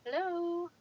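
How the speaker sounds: high bright hello to viewers; upbeat vlog hello; cheery greeting. Real, not acted. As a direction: pitch up and welcome everyone.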